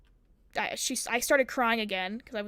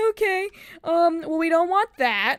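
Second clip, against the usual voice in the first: higher, louder mock-uneasy official; placating school staff; 6 semitones higher, 5 dB louder than usual. School answering an angry mom's threat, mock placating. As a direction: imitate an official backing down, pitched up.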